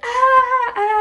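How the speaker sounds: loud, high mock shriek; hammed-up shrieking "ah"; imitated scream. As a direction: scream it like she's fleeing in panic. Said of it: "she's like".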